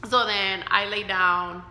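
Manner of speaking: usual speaking voice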